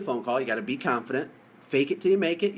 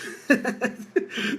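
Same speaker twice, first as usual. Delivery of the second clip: breathy laugh; 9 semitones higher than usual. Real, not acted. Laughs at failed pep talk.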